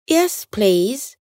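carefully courteous reply; a touch of effortful politeness; trying to be polite. A narrator's reading. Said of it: "trying his best to be polite".

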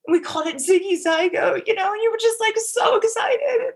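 getting emotional. Real, not acted. Remembering the lost pregnancy, voice breaking. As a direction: voice breaking high with real tears. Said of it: "I can't even believe I'm getting so emotional".

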